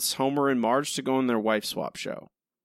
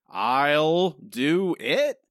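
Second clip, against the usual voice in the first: "I'll do it" stretched out very slowly, mocking Marge; mocking Marge's take; 3 semitones higher, 3 dB louder, much slower than usual. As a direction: drag out "I'll do it" like a dull retake. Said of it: "forty-five seconds of Marge going".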